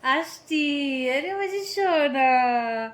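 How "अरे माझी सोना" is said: slow, doting coo; tender cooing. Real, not acted. Mother cooing at her little one, slow and doting.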